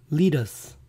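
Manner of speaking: "lettuce" as LEE-das, then LET-chus; quiz option pronunciation. Shown as a demonstration. Teacher offers decoy choices, "lettuce" as LEE-das, LET-chus. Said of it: "¿Cómo se debe pronunciar lechuga".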